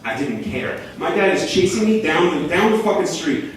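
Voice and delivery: ordinary talk, nothing marked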